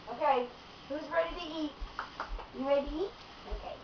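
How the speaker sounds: high singsong pet voice; talking to dogs. Real, not acted. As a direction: coax the dogs to eat. Calling dogs to dinner.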